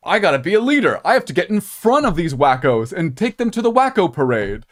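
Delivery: loud, high mock-earnest politician; mocking exasperation. Politician explaining leading wackos in Congress, loud mocking imitation. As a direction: push it up, loud and incredulous. Mocking Boehner's logic as quote.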